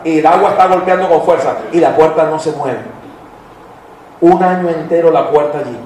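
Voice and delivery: usual speaking voice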